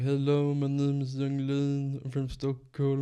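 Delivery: put-on slow Swedish-accented English greeting. Rapper introducing himself in an interview, slow Swedish-accented English. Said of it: "[name] always talked like that in interviews".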